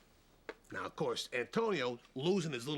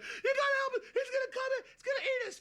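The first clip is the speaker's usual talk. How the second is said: terrified squeal; 4 dB louder, faster than usual. Acted.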